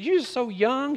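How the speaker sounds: a hint of a fond Louisiana mother-in-law. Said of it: "She said".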